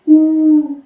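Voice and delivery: "ou" sounded slowly and sustained; drawn-out vowel sound. Shown as a demonstration. Teacher showing what O and U make, drawn-out sound. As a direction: hold "ou" as one long vowel. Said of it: "le O et le U".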